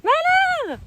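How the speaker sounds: high drawn-out cry of despair; dramatic wail. Real, not acted. Calling out to horse dramatically.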